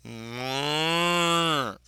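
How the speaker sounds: long moo swelling and rising in pitch; proper cow impression. Shown as a demonstration. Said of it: "let me give you a proper cow voice".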